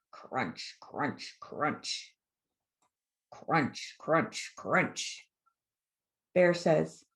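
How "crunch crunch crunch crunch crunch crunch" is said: low, hushed rhythmic crunches; ominous gnawing noise, evenly spaced; crunching sound effect. A narrator's reading. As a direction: say each crunch as a separate low chomp. Imitating gnawing on the tree.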